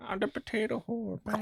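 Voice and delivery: high garbled potato man voice; unintelligible voice. Pretend. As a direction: mumble it as a weird creature. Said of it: "Like that".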